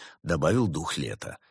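ordinary delivery

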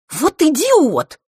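high, loud, drawn-out insult; exasperated. A narrator's reading. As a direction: blurt it out in rage. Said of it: "опять не стерпел Норт".

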